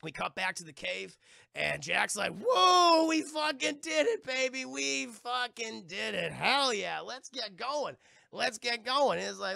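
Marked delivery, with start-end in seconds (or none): 2.4-9.2 high, loud cheer, easing down; hammed-up victory celebration; triumphant whooping. Playing Jack cheering in the cave, exultant whoop.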